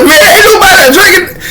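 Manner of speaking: laughing protest. Retold or imitated.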